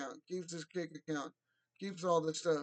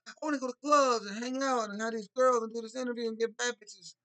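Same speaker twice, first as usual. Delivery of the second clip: mocking voice; 5 semitones higher, 6 dB louder than usual. Mocking influencer's wants.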